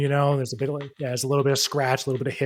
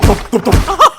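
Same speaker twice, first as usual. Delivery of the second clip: higher, loud vocal thumps; thumping sound effect; 10 semitones higher, 13 dB louder, slower than usual. Acted. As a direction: voice the thuds of wheels hitting. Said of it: "you hear a thump".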